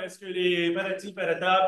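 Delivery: ordinary talk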